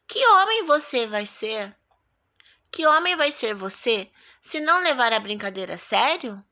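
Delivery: teacher models the question intonation. Shown as a demonstration. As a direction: ask it as a pointed question. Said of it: "pra ele poder falar dessa forma como eu falei".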